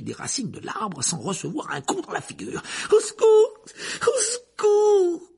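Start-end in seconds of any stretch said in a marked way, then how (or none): 2.9-3.6 loud, high cry for help; panicked cry. Acted. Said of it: "murmura précipitamment Hermione". 4.0-5.3 slow, high wailing plea; drawn-out cry. Acted. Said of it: "murmura précipitamment Hermione".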